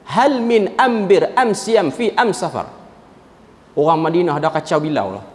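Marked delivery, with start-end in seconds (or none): 0.1-2.7 Huzail dialect, every "al" article said as "am"; village dialect Arabic. Retold or imitated. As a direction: say "am siam", "am safar" with m replacing l, drawn out slowly. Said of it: "dengan bahasa dialek kampung dia sendiri".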